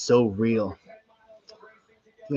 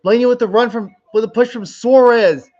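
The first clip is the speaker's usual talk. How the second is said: excited shouting; 10 semitones higher, 7 dB louder than usual. Real, not acted. Race finish, calling out.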